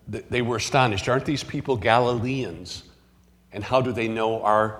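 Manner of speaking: normal talking voice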